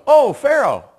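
loud, high "oh!" as it finally clicks; retold dawning recognition. Pastor imitating his dad finally getting the word, exaggerated realization. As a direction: imitate a dad suddenly catching on. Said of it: "And he finally goes".

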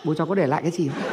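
low, soft, quick question; abrupt matter-of-fact switch. Acted. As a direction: drop the grief and quickly probe. Mock wail drops into inheritance question.

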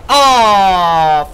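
loud, drawn-out groan of "oh"; exasperated outburst. Real, not acted. Gamer fuming at a failed sniper kill, exasperated outburst. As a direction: cry out in real frustration.

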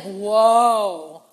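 loud, amazed whoa; awed whoa. Retold or imitated. Onlooker seeing the Red Sea split, loud awe. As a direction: let it burst out in awe.

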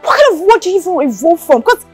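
loud, heated dressing-down; angry scolding. Acted. Scolding a woman for proposing to a man, angry.

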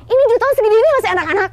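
high, loud, rapid incredulous question; astonished disbelief. Real, not acted. Host learning huge tigers are still children, loud astonishment. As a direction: burst out in disbelief, fast and loud.

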